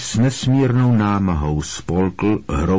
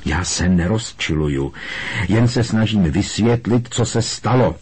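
ordinary delivery, nothing marked

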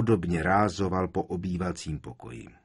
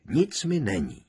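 higher, firmer repeat of the reassurance; reassuring; 4 semitones higher than usual. A narrator's reading. Ron patting her back, reassuring. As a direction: say it again more lightly, pitch lifted.

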